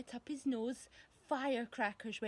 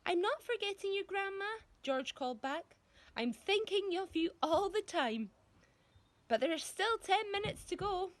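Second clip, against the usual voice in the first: put-on high, louder boy's voice calling out; boy's voice calling to the next room; George calling back; 8 semitones higher, 3 dB louder than usual. A narrator's reading. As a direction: reassure her a little too sweetly.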